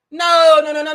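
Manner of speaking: ordinary talk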